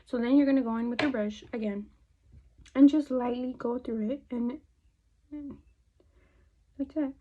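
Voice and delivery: ordinary talk, nothing marked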